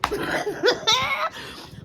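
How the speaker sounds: high-pitched burst of laughter. Real, not acted. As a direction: laugh out loud, tired and amused. Laugh after "I'm tired.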